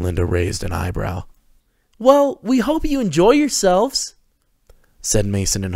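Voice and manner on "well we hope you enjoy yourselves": very high, slow sugary welcome; perky hosts. A narrator's reading. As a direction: overeager young hosts. Mason and Harper voiced.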